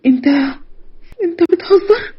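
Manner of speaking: high, distraught question; tearful disbelief. Acted. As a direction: ask in horrified disbelief. Victim cannot believe his answer.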